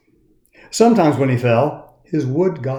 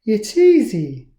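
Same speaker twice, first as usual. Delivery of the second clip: high, slow and easy; lightly voiced cheerful girl; Lucia voice; 9 semitones higher, slower than usual. A narrator's reading. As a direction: make it sound simple and kind. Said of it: "Lucia replied".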